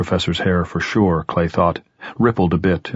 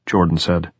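ordinary delivery, nothing marked

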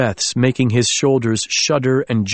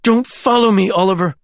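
petulant anger; 8 semitones higher than usual. Acted. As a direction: snap it like a sulking child. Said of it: "with a child's petulant anger".

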